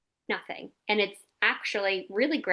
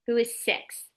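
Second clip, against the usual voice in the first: ordinary delivery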